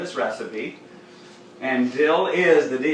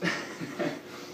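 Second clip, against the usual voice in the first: breathy laugh; 10 dB quieter than usual. Real, not acted. Laughs at own cat voice.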